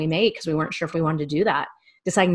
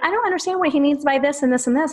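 put-on higher-pitched puzzled kid's question; imitating daughter; 9 semitones higher than usual. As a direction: retell a child's puzzlement in a higher voice. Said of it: "she's like".

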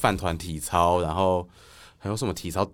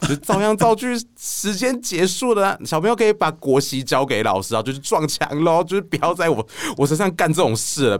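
mock teacher; 7 semitones higher than usual.

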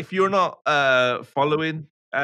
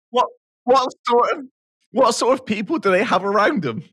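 loud, higher cry of disbelief; incredulous; 8 semitones higher, 6 dB louder than usual. Real, not acted. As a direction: demand it in amused shock. Incredulous about alcohol at breakfast.

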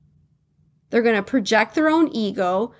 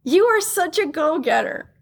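quoted compliment; 7 semitones higher than usual. Retold or imitated. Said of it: "And they said".

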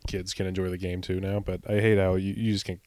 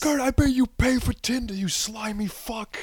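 high, shrill-pitched heckler yell; heckling scream. Retold or imitated. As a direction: scream it at the outfielder. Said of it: "would just be screaming at him".

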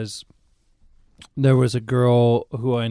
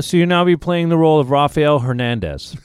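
louder, higher official declaration; imitated firm announcement; 4 semitones higher, 6 dB louder than usual. Producer calling back with new name, firm announcement. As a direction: announce it pointedly, as retold. Producer's second call, renamed role.

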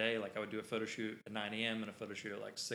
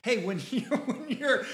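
laughing quote; 11 semitones higher, 7 dB louder, slower than usual. Retold or imitated. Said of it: "he was saying".

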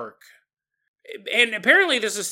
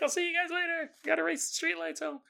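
higher, softer quick farewell; higher-pitched friend racing the streetlights; hurried goodbye; 11 semitones higher, 7 dB quieter, faster than usual. Retold or imitated. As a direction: toss it off while pedaling away. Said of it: "He's like".

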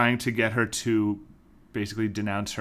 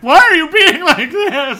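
very high, loud mock wail; imitating frustrated outburst; 13 dB louder than usual. Sam frustrated at her refusal, mock outburst. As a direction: blow up in hammed-up exasperation, half laughing. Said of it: "Sam just gets so frustrated".

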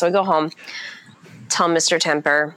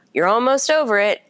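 higher, chirpy mock of people's comfort; mocking cheery platitude; 7 semitones higher than usual. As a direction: mimic the breezy reassurance, higher. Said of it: "Everybody's like".

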